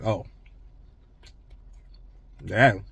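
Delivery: muffled words between chews; chewing while talking. Real, not acted. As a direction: mouth partly full of biscuit. Seen 1: Lips closed and working after bite; biscuit held below mouth throughout.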